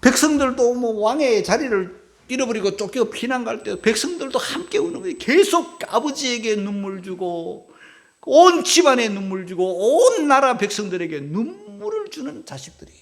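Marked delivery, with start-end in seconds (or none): none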